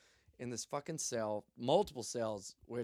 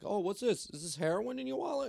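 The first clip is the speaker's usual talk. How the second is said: high-pitched teasing question; mock shocked discovery; 10 semitones higher than usual. Cop joking while searching the wallet, mock shocked discovery. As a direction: feign surprise, pitched way up.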